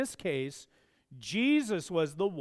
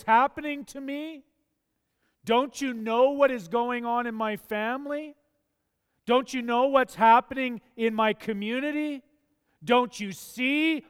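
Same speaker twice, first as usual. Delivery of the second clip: pleading complaint; 5 semitones higher, 5 dB louder than usual. Acted. Voicing a complaint prayer to God.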